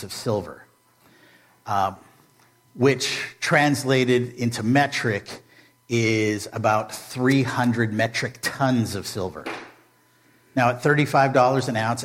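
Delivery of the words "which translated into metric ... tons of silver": usual speaking voice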